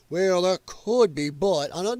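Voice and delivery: slow, faltering doctor voice; hesitant doctor. Retold or imitated. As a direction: stall like a caught-out doctor. Doctor's stammering excuse voiced.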